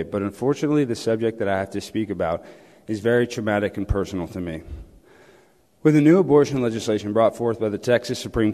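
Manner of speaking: low, fast read-out statement; formal speech. Pretend. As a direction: read a prepared speech briskly to the council, pitched low. Reads prepared speech to the mayor.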